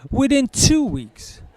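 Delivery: ordinary delivery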